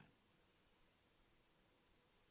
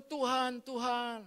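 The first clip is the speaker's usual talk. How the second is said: raised, drawn-out call to God; 5 semitones higher, slower than usual. Acted. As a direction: cry out to the Lord, stretching each call. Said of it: "Engkau berseru". (berseru memanggil Tuhan)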